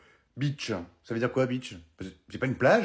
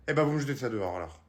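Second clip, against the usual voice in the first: usual speaking voice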